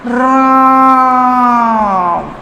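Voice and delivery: "राम" with the final vowel stretched very long and held; long drawn-out vowel. Shown as a demonstration. Teacher showing students the long vowel mark: "राम" stretched very long. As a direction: draw out "राम" as one long sustained sound. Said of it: "दीर्घ उच्चारण चिन्ह से खुदा बताया".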